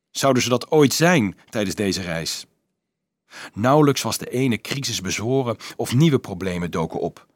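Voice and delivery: usual speaking voice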